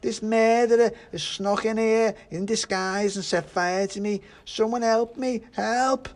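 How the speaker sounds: high, loud wail for help; burning pudding screaming for help; panicked pudding. A narrator's reading. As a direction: shriek accusations, frantic to be rescued.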